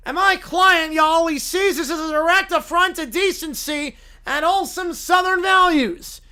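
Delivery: loud, pompous Southern lawyer voice. Acted. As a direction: preach moral outrage to the judge like a Southern showman. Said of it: "Southern blue-haired lawyer".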